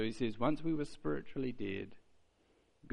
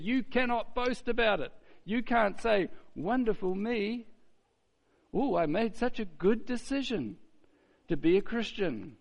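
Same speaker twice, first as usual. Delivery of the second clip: high, preening mock voice; mock self-praise; 8 semitones higher than usual. Preacher imitating a believer praising himself, lightly mocking.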